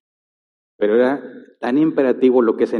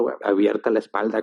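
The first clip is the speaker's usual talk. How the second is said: normal talking voice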